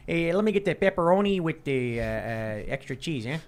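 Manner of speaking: put-on Chicago accent, ordering pizza. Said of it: "order your pizza with a Chicago accent".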